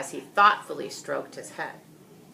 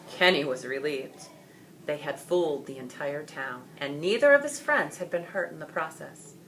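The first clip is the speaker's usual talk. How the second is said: usual speaking voice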